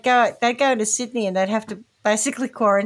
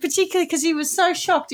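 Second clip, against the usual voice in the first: ordinary talk, nothing marked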